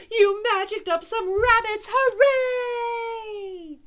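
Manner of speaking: high, loud jubilation; cheer, stretching out the hooray; squealing celebration. A narrator's reading. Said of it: "the other cats cheered".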